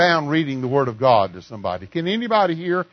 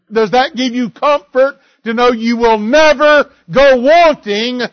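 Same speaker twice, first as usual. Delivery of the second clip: loud, higher, slow recitation of scripture; declaimed psalm; 6 semitones higher, 8 dB louder, slower than usual. Acted. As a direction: proclaim every line to the congregation. Reciting Psalm 23 at full force.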